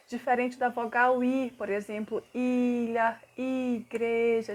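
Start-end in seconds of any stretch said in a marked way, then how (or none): 2.3-4.5 "ilha" and "igreja" said slowly with a half smile on the I; teacher demo, I stretched with a smile; half-smiling I. Shown as a demonstration. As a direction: smile through the I of "igreja", drawn out. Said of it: "a gente fala meio sorrindo".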